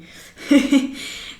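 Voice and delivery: short airy laugh at herself; a quick embarrassed laugh; breathy laugh. Real, not acted. Laughs at own forgetting.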